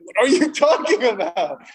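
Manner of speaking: baffled by the gift, half laughing; girlfriend confused, laughing. Retold or imitated. Girlfriend baffled by a computer mouse gift, laughing. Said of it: "She's like".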